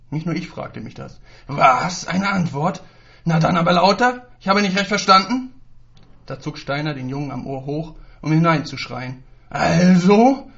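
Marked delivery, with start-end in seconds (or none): 1.5-5.5 high, loud angry shouting; wütendes Anschreien. Acted. Lehrer schreit Schüler an. 9.5-10.5 high, very loud cracking yell; überschlagende Stimme. Acted. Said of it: "Die Stimme des Lehrers überschlug sich".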